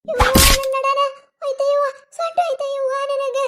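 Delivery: high, loud wailing cry; crying in pain. Acted. Woman thrown down wails to mother about her back. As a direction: wail over a hurt back. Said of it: "ಯಾಕ್ ಅಳ್ತಿ?".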